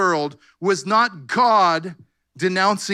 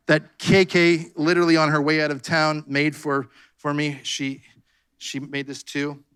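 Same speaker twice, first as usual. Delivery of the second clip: normal talking voice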